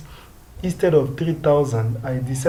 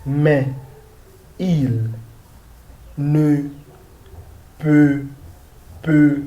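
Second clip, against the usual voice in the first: slow French, long pauses between words; deliberate, spaced-out French reading; word-by-word model reading; much slower than usual. Shown as a demonstration. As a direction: read it out for learners, one word at a time.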